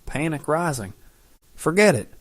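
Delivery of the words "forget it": sharp, higher and louder dismissal; curt angry brush-off; spat out. Acted. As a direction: spit the words back at her. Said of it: "he spat".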